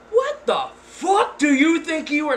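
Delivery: loud, booming yell up high; big booming voice. Retold or imitated. Shouting down the date who climbed into her car. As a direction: boom it out like an angry father. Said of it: "in one of my biggest booming voice".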